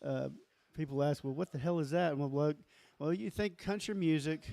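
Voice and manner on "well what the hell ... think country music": ordinary talk, nothing marked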